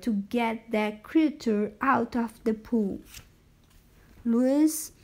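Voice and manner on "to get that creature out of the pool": usual speaking voice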